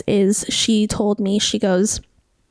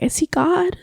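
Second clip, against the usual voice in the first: higher, lightly imitated tearful awe; retold tearful cry; 7 semitones higher than usual. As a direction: recall it as if crying, pitched up. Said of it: "I'm crying, I'm crying".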